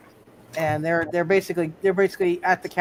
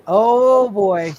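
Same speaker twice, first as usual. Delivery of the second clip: loud, drawn-out "oh" falling away; drawn-out dismay; 8 dB louder than usual. Real, not acted. Game master reacting to a ninety-four roll, drawn-out dismay.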